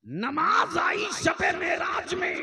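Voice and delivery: high, drawn-out shout; preacher's shouted declamation. Real, not acted.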